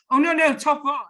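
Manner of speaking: mock client butting in loudly; eager correction. Acted client eagerly correcting the salesman.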